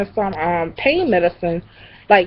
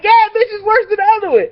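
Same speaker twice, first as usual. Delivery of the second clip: higher, fast complaint; exasperated cousin; 12 semitones higher, much faster than usual. Retold or imitated. Cousin complaining about the smell, exasperated. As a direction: rattle it off, high and annoyed. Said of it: "She was like".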